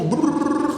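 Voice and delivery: high trilled brrr noise; long high buzzing trill; rolled-lip brrr. Shown as a demonstration. Guest illustrating the group to host, high trilled brrr.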